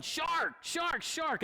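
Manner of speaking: retold panicky yell, high but contained; mock scared yell. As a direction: imitate a frightened man yelling a warning. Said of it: "he's yelling".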